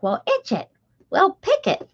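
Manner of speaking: grandma voice. Retold or imitated. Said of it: "she'd be like".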